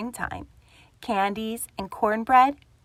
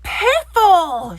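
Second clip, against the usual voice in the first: angry shout, higher and much louder; 9 semitones higher, 10 dB louder than usual. Acted. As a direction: bellow it at the door. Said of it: "shouted Grumpy Badger".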